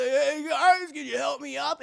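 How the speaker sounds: high, strained cartoon call; calling for help. Hero hanging by his bandages, high strained plea. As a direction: pitch it up as if dangling from a ledge.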